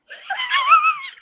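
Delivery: high shrieking laugh; burst of laughter. Real, not acted. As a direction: crack up in a squeal. Reads funny chat comment.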